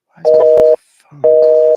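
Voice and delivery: loud, exasperated shout at the phone; frustrated outburst. Real, not acted. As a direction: bark it out in annoyance.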